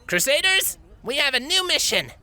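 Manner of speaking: high, bold filly rallying her team; rallying announcement. Acted. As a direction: call the troops like a little general. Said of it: "Blitz announced".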